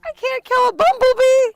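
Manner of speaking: high-pitched, very loud outcry; real shriek. Real, not acted. Filming outdoors, startled over a bumblebee, shrieking. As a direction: cry out in sudden alarm.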